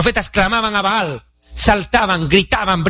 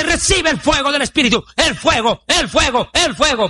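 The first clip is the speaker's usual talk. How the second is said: lightly mimicked revival preacher, raised pitch; higher, chant-like mock preaching; mock prosperity preacher; 6 semitones higher than usual. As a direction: parody a preacher's insistent, repeated call. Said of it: "iglesias de la prosperidad que hablan de".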